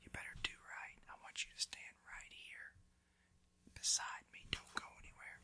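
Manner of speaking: very soft, voiceless warning; whisper. Shown as a demonstration. Parent keeping a child beside them, whispering. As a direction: whisper firm orders right into the child's ear. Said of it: "because you're whispering to them".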